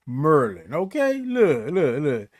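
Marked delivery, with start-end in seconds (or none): 0.1-0.7 "Maryland" squeezed into two syllables, like "Merlin"; "Maryland" with the middle vowel dropped, MUR-lin; local pronunciation. Shown as a demonstration. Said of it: "they say".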